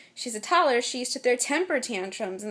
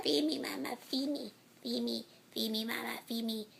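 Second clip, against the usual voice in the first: small, soft pretend baby voice; baby's voice; 8 dB quieter than usual. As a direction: softly play a pleading infant. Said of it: "She's saying".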